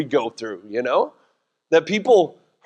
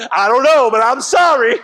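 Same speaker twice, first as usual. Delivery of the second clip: louder, higher blurted sorry; comic shouted apology; 6 semitones higher, 5 dB louder than usual. Retold or imitated. Husband to wife Pinky, loud comic apology. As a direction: yell the apology, clueless.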